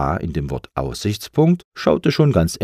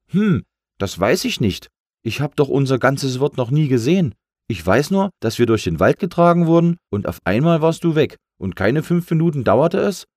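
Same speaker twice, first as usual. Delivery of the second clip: high, louder, animated little-letter voice; little C, aggrieved; 8 semitones higher, 4 dB louder than usual. A narrator's reading. Little C explains to big A how it fell, plaintive. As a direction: recount your misfortune in a high voice.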